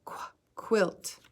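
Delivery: "qu" breathed out unvoiced; teacher whispering the qu sound; whispered qu sound. Shown as a demonstration. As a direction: whisper the "qu" crisply. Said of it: "Q makes the sound qu".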